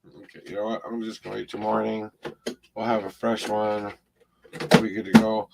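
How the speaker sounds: low rough voice giving out; gravelly voice. Real, not acted. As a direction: croak through it as the voice fails. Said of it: "I got kind of a gravelly voice there".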